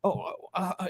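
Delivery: unsure mumble when the call is answered; confused fumbling. Shown as a demonstration. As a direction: fumble like you've lost your nerve. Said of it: "you sound confused and you're fumbling".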